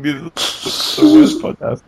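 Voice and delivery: slow, yawning through the words. Real, not acted. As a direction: speak mid-yawn. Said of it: "oh man, I'm yawning".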